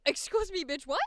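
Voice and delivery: loud, indignant comeback; hammed-up affronted snap; mock offended. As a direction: bristle at the insult, loudly.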